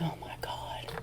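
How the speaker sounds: hushed gasp of dread; whispered oh my God; shocked whisper. Retold or imitated. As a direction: breathe it out in a whisper. Retold shock seeing truck.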